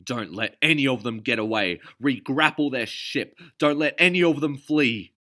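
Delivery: loud commanding bark; pirate mate commanding his crew; shouted orders. A narrator's reading. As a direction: bark it across the deck.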